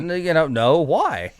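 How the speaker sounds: high, innocent mock confusion; answering the firefighters with mock innocence, high and clueless; playing dumb. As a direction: act clueless, pitch up. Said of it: "I just played stupid".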